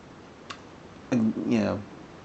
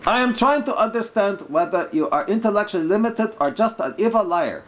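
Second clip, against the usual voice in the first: mock Arabic accent; 7 semitones higher than usual. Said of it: "when I'm doing the mock Arabic accent".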